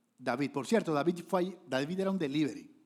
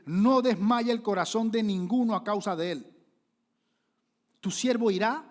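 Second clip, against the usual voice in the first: loud, higher ringing proclamation; bold declaration; 6 semitones higher, 7 dB louder than usual. Retold or imitated. Young David calling to frightened soldiers, loud and bold. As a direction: cry it out to stir the frightened men.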